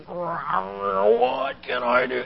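drawn-out, effortful groaned words; mock struggle, words dragged out; acted straining. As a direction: act out straining in a wrestling hold, very slowly. Acting out his struggling thoughts mid-match.

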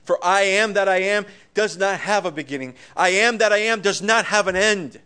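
full-throated sermon voice; preaching at full force. Acted. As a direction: proclaim it to the whole congregation. Opening declaration, preaching.

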